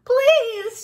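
high, loud plea; desperate shout. A narrator's reading. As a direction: beg at the top of the voice. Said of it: "shouted the mouse".

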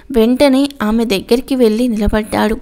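usual speaking voice

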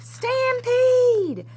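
high, loud drawn-out yell. Acted. Hungry cowboys yelling as they chase the cookie, loud holler. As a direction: holler it across the range. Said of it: "The cowboys yelled".

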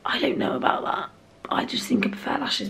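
unvoiced doubtful whisper. Real, not acted. Whispering a doubt about her mascara to camera. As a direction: whisper it aside, unsure.

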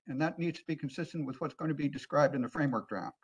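ordinary talk, nothing marked

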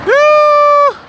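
high, loud shocked yoo; loud surprised yell. Real, not acted. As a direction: shout it in disbelief. Exclamation at the truck.

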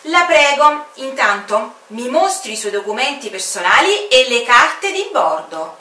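stern official exclamation; exclaiming commander. Acted. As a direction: demand the papers firmly. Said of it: "esclamò il comandante".

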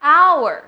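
HOUR as "ow-er", loud, high, falling; silent H. Shown as a demonstration. Teacher asking students to say it after her, silent H. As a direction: model "hour" clearly without any H. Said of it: "เดี๋ยวลองพูดตามนะคะ".

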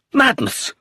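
high-pitched exclamation of disbelief; agitated outburst. A narrator's reading. Roger rails at his father's marriage, high agitated outburst. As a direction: cry it out in exasperation.

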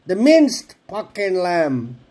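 slow, exasperated, each word separate. Real, not acted. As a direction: spell it out in frustration. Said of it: "Oh my God, what is going on".

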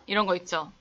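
usual speaking voice

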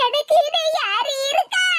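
slow, self-pitying wail; hammed-up sobbing lament; mock crying. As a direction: bawl it out with stretched vowels. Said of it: "நீ என்னத்துக்கு மக்கா அழுத".

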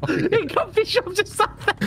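squeaky laughing. Real, not acted.

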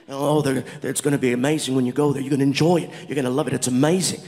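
put-on low, casual British accent. British Indians cheering on the comedian's India trip, British accent.

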